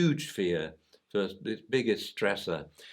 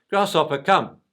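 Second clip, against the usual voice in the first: higher, louder owner calling the dog; owner's stern call, raised; 6 semitones higher, 9 dB louder than usual. Retold or imitated. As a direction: call the dog sharply across the park. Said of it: "he gets a bit louder".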